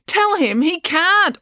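high, loud, stretched-out command; insistent order. A narrator's reading. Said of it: "ordered April".

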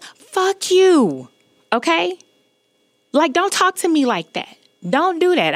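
high-pitched, indignant re-enacted outburst; retold angry cursing. Woman who lost it at catcaller, re-enacted angry cursing. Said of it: "cursing this man".

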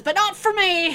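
high, loud proud boast; boastful sea captain. Acted. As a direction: crow it like a cocky old skipper. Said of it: "and he says".

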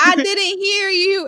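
high, slow sing-song mimicry of a teacher; teacher's prompting. Professor waiting for class to answer, imitated prompting. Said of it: "she'd sit there and be like".

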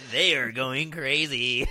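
loud, high, drawn-out mock announcer; hammed-up play-by-play imitation; broadcaster echo. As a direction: belt it out slowly like a sportscaster. Echoes the broadcaster impression.

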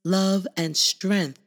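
measured, reverent poem reading with long pauses; slow spoken-word affirmation. Acted. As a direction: read it like a calm blessing. Spoken-word affirmation over music.

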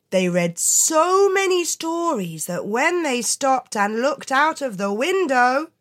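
ordinary delivery, nothing marked

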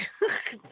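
short unvoiced laugh at the slip; a quick embarrassed chuckle; real breathy laugh. Real, not acted. Narrator laughing at her own misreading, short unvoiced laugh.

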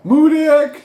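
shrill, loud mangled "Mudick!"; loud high mock cry of Mudick; mocking yell. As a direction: screech the word like a cow in pain. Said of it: "I'm yelling medic".

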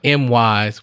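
ordinary delivery

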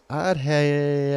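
ordinary talk, nothing marked

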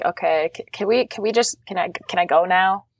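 huffy. Acted. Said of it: "give out a huff and be like".

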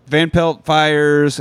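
ordinary talk, nothing marked

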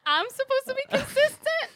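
very high, loud, incredulous squeal; mock outraged disbelief. Retelling reaction to cousin's question, mock outraged disbelief. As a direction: squeal it like a stung protest. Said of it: "I felt like I was slapped in the face".

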